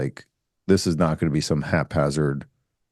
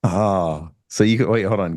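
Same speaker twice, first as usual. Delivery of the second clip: usual speaking voice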